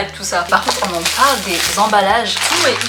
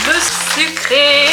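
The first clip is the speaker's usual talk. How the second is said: higher and louder announcement; excited; 6 semitones higher, 3 dB louder, slower than usual. Real, not acted. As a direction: announce it with a bounce. Announcing the sweet section.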